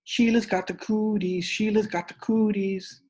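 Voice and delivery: put-on high chanting kid voice; hollered taunt. Boys teasing Sheila, high hollered chant. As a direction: chant it back at her. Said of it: "We hollered really loud".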